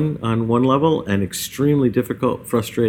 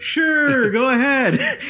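very high, drawn-out sarcastic sure; hammed-up, sing-song fake permission; exaggerated sarcasm; much slower than usual. Shown as a demonstration. Showing listeners the raid leader's sarcasm, exaggerated. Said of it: "do you hear the sarcasm in that".

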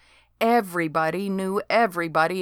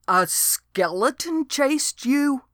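Dode's voice; 7 semitones higher, slower than usual. A narrator's reading. Dode voiced in story.

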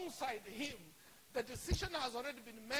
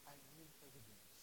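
very low, soft voice; subdued sceptical murmur; hushed doubt; 11 semitones lower, 20 dB quieter than usual. Acted. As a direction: quietly insist on proof. Voices disciples' doubting answer.

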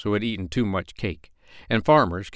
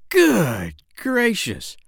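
slow, higher amazed exclamation; surprised cry; 12 semitones higher, 5 dB louder, much slower than usual. Acted. Doctor amazed at the parrot's bird talk, slow surprised cry. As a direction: gasp it out in wonder. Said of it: "cried the doctor".

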